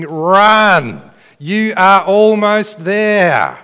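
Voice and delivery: loud, drawn-out high shout; screaming encouragement. Acted. Saints of church history to the runner, screamed encouragement. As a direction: holler it slowly across a packed stadium. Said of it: "and they're screaming".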